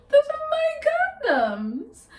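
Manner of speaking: very high, drawn-out squeal of objection; mock whiny protest. Woman objecting to date taking her condoms, hammed-up high whine.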